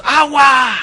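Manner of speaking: mock crowd yelling the answer; crowd shout. (la multitud grita en coro)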